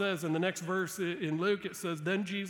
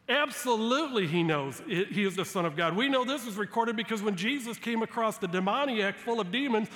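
usual speaking voice

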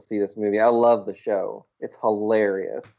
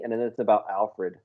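ordinary talk, nothing marked

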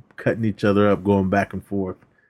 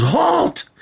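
very high, sudden call to halt; mock guard's command. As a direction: call it out like an overeager security guard. Guard stopping a car.

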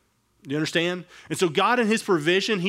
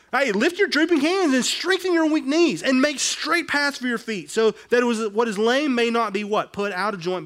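high, loud, pressing preaching; preaching climax; 6 semitones higher, 4 dB louder than usual. Real, not acted. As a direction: lift the crowd with the verse.

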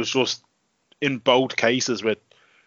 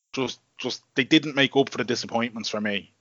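usual speaking voice

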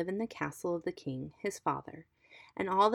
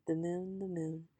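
fading echo; 6 dB quieter than usual. A narrator's reading. Narrator echoes the poem's closing refrain, softly fading. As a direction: let the repeated words die away softly.

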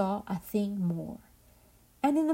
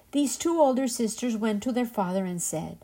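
usual speaking voice

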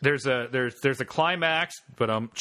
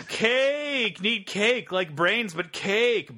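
high, grunting zombie craving; 6 semitones higher, much slower than usual. Acted. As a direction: moan for cake like a zombie.

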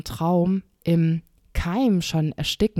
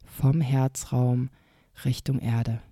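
guided meditation. Shown as a demonstration. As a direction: lead a gentle inner journey. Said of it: "eine kleine Meditationsübung mit dir machen".

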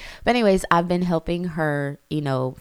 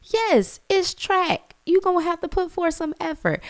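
shrill, very high, louder disbelief; outraged mom; 3 dB louder than usual. Retold or imitated. Mom reacting to daughter calling track hard, very high and indignant. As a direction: blow up in mock shock. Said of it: "I'm like".

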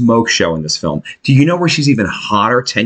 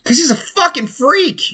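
mocking high voice; 13 semitones higher than usual. Mocking Corey Feldman.

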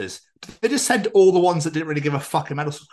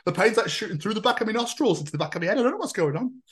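squeaky, hammed-up imitation of his mate, much higher; 10 semitones higher than usual. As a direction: play the friend as a ridiculous whiner, pitched way up. Mocking friend's next diagnosis.

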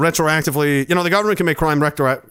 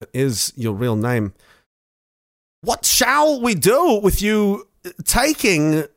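slow, halting Walken-style cadence; Christopher Walken impression; slower than usual. Walken as president questioning the prime minister, halting. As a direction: stretch the pauses like Walken. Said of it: "Please say it's Christopher Walken".

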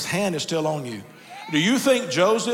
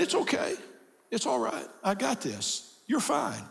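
high, softer comforting words; soothing a child; 5 semitones higher, 4 dB quieter than usual. Acted. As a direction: hush a frightened child gently. Comforting uneasy grandchildren.

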